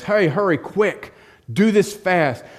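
loud, high, urgent orders to the servant; urgent call. Acted. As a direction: hurry them along, calling out. Father urgently calls servant.

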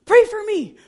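high, loud, desperate plea; urgent plea. Retold or imitated. Player grabs her coach's hands before the final play, loud urgent plea. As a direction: beg for prayer with the clock running out.

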